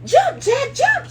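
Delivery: very high, loud holler; hollering mother. Acted. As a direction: scream at him to jump. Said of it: "she hollered".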